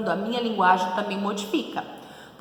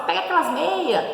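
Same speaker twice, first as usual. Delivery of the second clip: higher quick meia dropping the s; hurried casual; 9 semitones higher than usual. Retold or imitated. At home in a hurry to a brother, quick. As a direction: toss it off in a rush. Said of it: "você tá com pressa".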